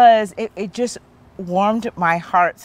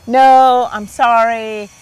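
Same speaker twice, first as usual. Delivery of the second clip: loud, higher, drawn-out mock refusal; firm refusal; 4 semitones higher, 8 dB louder, much slower than usual. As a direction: turn them down with a big, loud no. Acts out turning down suitor.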